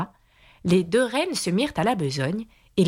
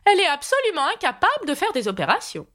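high, quick pronouncement; emphatic exclamation; 12 semitones higher, faster than usual. A narrator's reading. Two queens declaring Alice hopeless at once, high emphatic voice. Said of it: "d'une voix forte".

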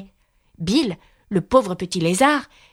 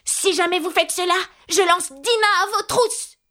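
cri à pleins poumons; 11 semitones higher than usual. A narrator's reading. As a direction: cry it out high, slowing on the threat. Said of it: "s'écria Alice de toute la force de ses poumons". (menace criée d'une voix aiguë)

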